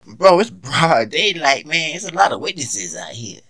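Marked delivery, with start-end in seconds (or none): none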